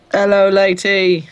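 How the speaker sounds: high, loud mock-Cockney greeting; put-on accent. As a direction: hail her in a hammed-up, vague Cockney accent. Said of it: "You like my accent?".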